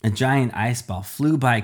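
ordinary delivery

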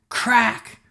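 high, punchy impact word; sound effect word. A narrator's reading. Sound of hitting the wall.

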